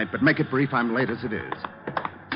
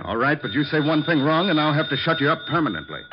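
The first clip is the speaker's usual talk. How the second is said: higher and a bit louder, rushing the warning; menacing threat; 6 semitones higher, 4 dB louder than usual. Acted. Crook warning the detective before the phone call, menacing. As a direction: threaten him fast and hard.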